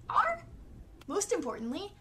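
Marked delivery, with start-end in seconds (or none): 0.1-0.4 high, loud hammed-up pirate "Arr!". Shown as a demonstration. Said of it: "as a conversational filler".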